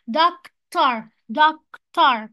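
"doctor" broken as doc... tor; split into syllables. Shown as a demonstration. Teacher shows two syllables, "doctor" split by a gap. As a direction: say "doctor" in two separate loud beats. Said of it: "دي برضو two syllables".

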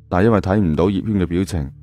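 usual speaking voice

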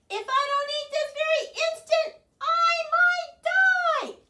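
loud high overacted plea to friends; dramatic wail. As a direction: ham up dying of hunger. Starving Bird's dramatic plea.

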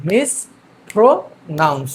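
মিসপ্রোনাউন্স slowly in three separate beats; three-syllable breakdown. Shown as a demonstration. Teacher shows the three syllables, মিসপ্রোনাউন্স slowly broken up. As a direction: split মিসপ্রোনাউন্স into mis, pro, nounce with pauses. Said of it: "এখানে তিনটা, আহ, syllable রয়েছে".

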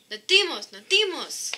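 urgent eager call to swim. Acted. Chased by sharks, urging Tuga to swim, urgent call. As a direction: urge the turtle to hurry. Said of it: "We better swim even faster".

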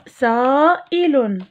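سائل stretched slowly; teacher drawing out سائل; slow model word. Shown as a demonstration. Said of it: "المقطع الثاني".

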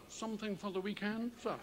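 low, soft offer with a rising question on sir; discreet barber. Retold or imitated. Barber quietly offers a customer condoms, soft and low. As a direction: hand it over on the quiet. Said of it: "the line from the barber".

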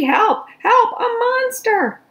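high, loud panicked screams; panicked cries. Acted. Baby screaming for help at the monster. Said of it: "shouted Baby".

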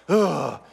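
exasperated eye-roll ugh; a fed-up groan; annoyed groan. Retold or imitated. Husband groaning at the garbage request, exasperated. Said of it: "roll your eyes and go".

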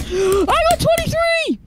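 drawn-out high-pitched cry of triumph; excited cry. Real, not acted. Gamer announcing a score to a friend, excited cry. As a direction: yell it high, stretching the words.

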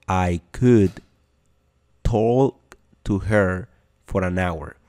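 slow, pause after could; slow teacher model line; slow, split demo. Shown as a demonstration. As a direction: drag it out for learners to copy.

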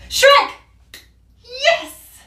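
high, loud shout of triumph; triumphant shout. Real, not acted. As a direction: shout the winning answer.

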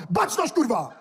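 barked army command; drill sergeant. Pretend. Mocking ex-soldier, barking command.

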